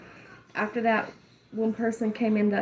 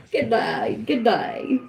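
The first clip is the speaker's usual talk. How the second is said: louder, brighter take of the greeting; mock British greeting; 3 dB louder, slower than usual. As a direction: announce yourself like a hammed-up royal. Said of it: "The queen has arrived".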